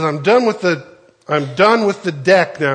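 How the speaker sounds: acted exasperation, pushed out; fed-up kid. Fed-up kid reports to teacher.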